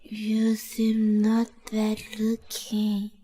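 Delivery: slow, tipsy drawl; drunk admiring. Acted. Drunk woman compliments her host's looks, tipsy drawl.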